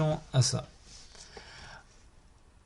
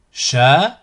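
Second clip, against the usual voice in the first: split into syllables; 5 dB louder than usual. Shown as a demonstration. Teacher models a word for learners to repeat, split into syllables. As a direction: cut the word up, pausing between syllables. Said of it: "décomposer le mot".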